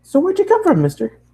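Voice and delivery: high, chirpy asking; bubbly question. Acted. Bubbly girl asks the traveler where he's from, high. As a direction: pepper him with cheerful curiosity. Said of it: "the bubbly girl asked".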